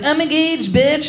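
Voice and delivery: high, cocky "I'm engaged, bitch"; gloating friend. Retold or imitated. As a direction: brag it up high and sassy. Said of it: "They're always like".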